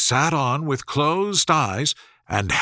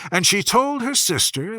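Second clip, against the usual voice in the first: ordinary delivery, nothing marked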